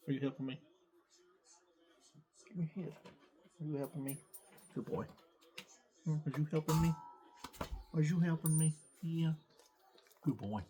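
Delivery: talking to the dog. Real, not acted. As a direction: coo at the dog beside you. Talking to his German Shepherd.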